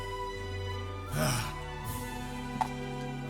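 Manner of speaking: long, soft exhale; resigned sigh. Acted. Officer accepting that the lord has gone, resigned sigh.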